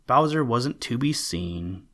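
ordinary delivery

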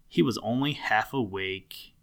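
usual speaking voice

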